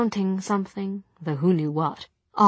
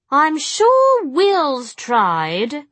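high, loud bored drawl; 9 semitones higher, 7 dB louder, much slower than usual. A narrator's reading. Jessamine remarking on Will at dinner, bored. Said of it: "said Jessamine in a bored tone".

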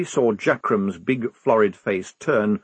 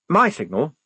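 lightly firm officer, pitched up; higher officer voice, mildly insistent; 6 semitones higher than usual. A narrator's reading. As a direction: correct a subordinate firmly, voice raised in pitch. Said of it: "said Blouse firmly".

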